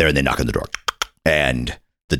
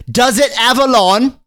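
put-on much higher, prim boy's voice; snooty little boy; 4 dB louder than usual. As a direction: look down your nose like a posh little boy. Boy asking about the lawn.

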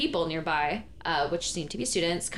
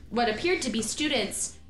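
ordinary talk

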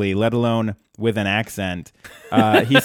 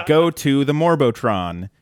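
ordinary talk

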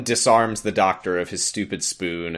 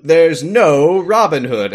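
slow, loud, higher insistence; emphatic Doctor quote; 6 semitones higher, 7 dB louder, slower than usual. Retold or imitated. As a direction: declare it slowly and loudly. Doctor quoted in episode recap.

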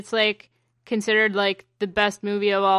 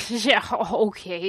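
sarcastic; 2 semitones lower, much slower than usual. Retold or imitated. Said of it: "I was like".

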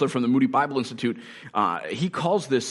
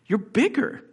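put-on high-pitched childlike wonder; read-aloud amazed little girl, much higher; Lucy; 11 semitones higher than usual. A narrator's reading. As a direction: marvel at it, voice lifting high. Narrator voices child Lucy's wonder.

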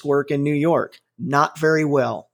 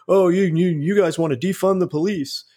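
lightly voiced sneering commenter, a little higher; a hint of a snide know-it-all throwing the jab; mocking online commenter; 4 semitones higher than usual. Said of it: "some people are like".

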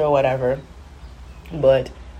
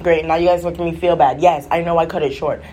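ordinary talk, nothing marked

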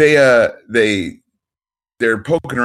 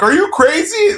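very high-pitched, aghast question; hammed-up incredulous outburst; mock incredulity. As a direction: squeal it in put-on disbelief. Islanders voiced, incredulous at crew.

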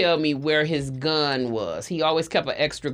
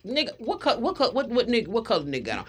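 stammering start speeding into a rush; talking really fast; 5 semitones higher, 3 dB quieter, faster than usual. Retold or imitated. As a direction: imitate someone too worked up to finish the question. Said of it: "He's talking really fast".

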